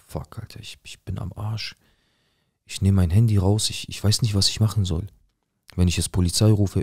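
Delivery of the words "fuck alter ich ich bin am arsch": hushed whisper of despair; thinking aloud in a frightened whisper; whispered panic. Retold or imitated. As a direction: mouth it to yourself in fear. Inner thought, hearing noise again.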